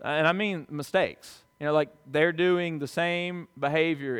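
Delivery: ordinary talk, nothing marked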